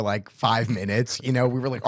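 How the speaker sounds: ordinary talk, nothing marked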